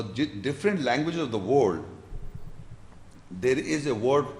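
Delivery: ordinary talk, nothing marked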